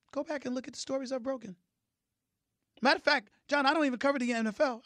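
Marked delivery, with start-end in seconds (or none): 2.8-4.8 fast, high-pitched protest at normal volume; quick protest. Real, not acted. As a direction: rattle it off high, without shouting. First, unshouted take of boast.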